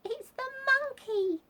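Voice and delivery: high, loud, halting squeal; gasping revelation. A narrator's reading. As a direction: squeal the discovery in bursts. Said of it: "she gasped".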